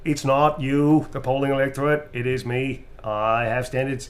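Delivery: politician-style Boston parody; mock Boston accent. Playing on the standards line in mock Boston. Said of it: "it sounded like Boston".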